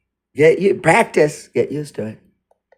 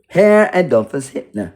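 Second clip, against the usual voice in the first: ordinary talk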